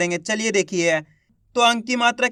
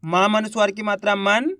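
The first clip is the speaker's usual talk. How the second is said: normal talking voice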